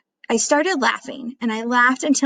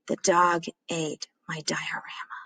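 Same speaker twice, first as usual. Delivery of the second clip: soft, stunned whisper to yourself; 3 semitones lower, 6 dB quieter than usual. Acted. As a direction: barely breathe the words in disbelief. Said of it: "Then I said it out loud".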